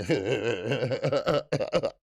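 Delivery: long helpless laugh, catching his breath; cracked up mid-sentence; choking laugh. Real, not acted. To viewers, cracking up over the doubters, choking laugh. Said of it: "I got choked for a minute".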